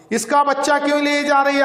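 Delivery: sharp, fast, shouted demand; stern constable. Acted. Constable sternly shouting at the woman taking the child. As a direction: bark at her for an answer. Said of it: "हवलदार ने कड़क कर कहा".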